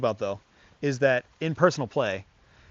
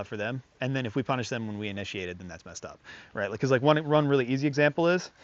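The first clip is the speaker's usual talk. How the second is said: usual speaking voice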